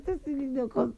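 ordinary talk

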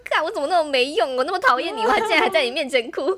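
venting at herself, half laughing; mock furious inner rant. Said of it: "我超气".

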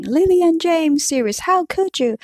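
high, pleading mock sob; a retold cry of betrayal, pitched high up; imitating sobbing. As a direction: Pettigrew crying out at Sirius, mock sobbing. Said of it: "Muggle said he was sobbing".

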